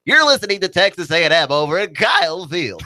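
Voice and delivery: play-by-play announcer. Retold or imitated. Co-host mocking the Texas A and M play-by-play guy, announcer voice. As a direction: imitate an over-the-top game caller. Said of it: "doesn't actually talk like how he announces".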